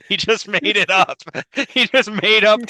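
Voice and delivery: high-pitched words through real laughter; cracking up. Real, not acted. Podcaster amused by the made-up game story, laughing hard. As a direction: barely get it out for laughing.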